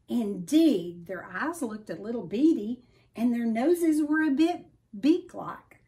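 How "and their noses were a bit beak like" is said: usual speaking voice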